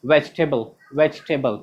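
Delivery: VEGETABLE as low, falling vej-tebl; correct pronunciation. Shown as a demonstration. Teacher has students repeat, वेजिटेबल as "vej-tebl". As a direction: say वेजिटेबल as a drill, middle vowel dropped. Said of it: "फिर से पढ़िए".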